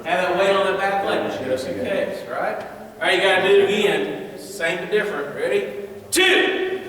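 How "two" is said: loud, high count shouted out; called drill count. Real, not acted. Coach counts punches for the class, loud high call. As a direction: bark the number to the class.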